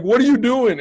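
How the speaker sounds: high, loud sharp yell; retold yell. Father yelling at the son who hit his brother. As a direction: bark it at the kid who hit. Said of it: "I'm like".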